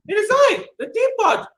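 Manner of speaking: high, loud little teapot. Acted. Teapot answering the robber, high and loud. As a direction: announce yourself proudly.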